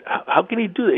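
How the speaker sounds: high-pitched indignant mock protest; retold angry outburst. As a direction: replay your disbelief, pitched well up. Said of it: "I, I got mad".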